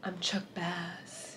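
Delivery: soft, slow, lightly imitated English accent; Chuck Bass English accent. Vlogger imitating the character's English accent, softly. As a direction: murmur it like a smooth English operator. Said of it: "Is that the Chuck Bass voice?".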